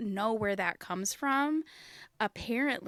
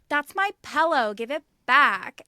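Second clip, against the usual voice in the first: put-on mad Midwestern accent; 3 semitones higher, 11 dB louder than usual. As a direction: play up an angry Midwestern accent. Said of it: "When I'm, like, so mad".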